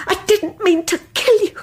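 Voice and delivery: ordinary delivery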